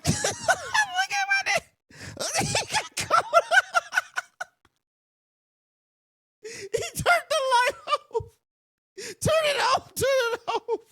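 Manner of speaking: shrieking through laughter; high, breathless laughing words; hysterical laughter. Real, not acted. Howling with laughter at the clip.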